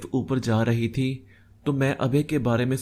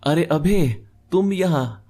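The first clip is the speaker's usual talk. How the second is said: surprised, raised-pitch hello; startled greeting; 6 semitones higher, 4 dB louder than usual. A narrator's reading. Narrator finding old friend Abhay at the lift, startled. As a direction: exclaim it as if caught off guard. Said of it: "मैं चौंक सा गया".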